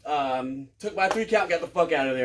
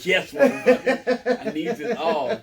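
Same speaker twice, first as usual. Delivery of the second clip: mock greedy cheer; 5 semitones higher, 4 dB louder, slower than usual. Mock greedy thrift store buyer.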